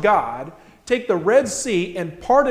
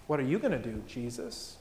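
low, soft question put to Jesus; trusting question; 6 semitones lower, 11 dB quieter than usual. Acted. Believer handing the problem to Jesus, trusting question. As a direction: drop the voice and hand it over gently.

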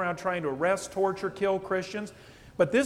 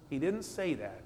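normal talking voice

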